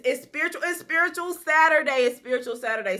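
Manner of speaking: high, loud hype greeting; excited. Real, not acted. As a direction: sing it out big and excited. Hyped greeting to a viewer.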